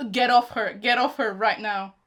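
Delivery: a fan yelling at the screen, real and loud. Real, not acted. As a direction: shout get off her at the TV.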